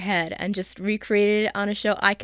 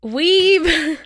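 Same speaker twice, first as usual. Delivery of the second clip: teasing; 9 semitones higher, 6 dB louder than usual. Real, not acted. Teasing insult.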